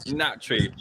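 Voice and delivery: ordinary talk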